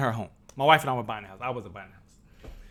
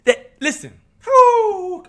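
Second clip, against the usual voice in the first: high, loud, near tears; worked up; 13 semitones higher, 7 dB louder, much slower than usual. Real, not acted. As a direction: overcome and catching himself. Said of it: "Okay, calm down".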